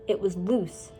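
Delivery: ordinary delivery, nothing marked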